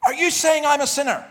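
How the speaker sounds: loud, high-pitched shouted challenge; retold yell. Heckler at a debate yelling at the speaker, loud furious shout. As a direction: bellow it like an outraged heckler. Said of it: "this guy stands up and he yells".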